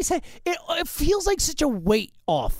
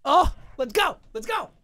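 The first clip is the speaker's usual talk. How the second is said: cheer; 5 semitones higher than usual. Real, not acted. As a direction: shout it to the crowd.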